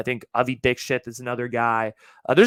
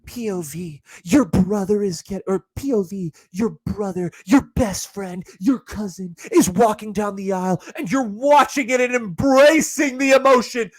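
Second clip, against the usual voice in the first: raised, building wedding-video voice; mock POV narrator; 6 semitones higher, 5 dB louder than usual. Podcaster parodying wedding POV videos, swelling melodrama. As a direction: build from announcing to loud, high melodrama.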